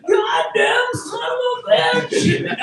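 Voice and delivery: high, slow, hammed-up angry shout; imitating dad's furious curse. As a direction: bellow it like the furious dad dragging a kid out. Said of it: "you hear my dad going".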